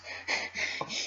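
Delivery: strained, nervous little laugh; forced desperate giggle. Acted. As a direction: giggle as if forcing it out. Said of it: "forced, desperate giggles".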